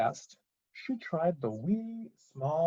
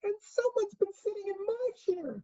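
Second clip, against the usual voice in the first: very high, tearful cub; squeaky little crying bear; wee small Baby Bear. Acted. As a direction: wail it in a tiny bear voice. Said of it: "cried the Baby Bear in his wee".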